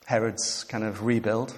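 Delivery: ordinary talk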